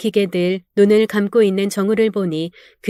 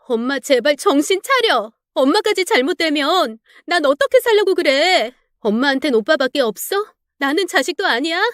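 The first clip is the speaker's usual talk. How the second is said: higher daughter voice, a mild pleading wail; hint of a tearful daughter, higher; 6 semitones higher than usual. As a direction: beg her mother desperately. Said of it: "딸은 울부짖으며".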